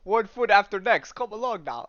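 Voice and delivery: lightly voiced Russian-accented dog. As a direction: usher them along with a touch of Russian accent. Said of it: "it's in a Russian accent".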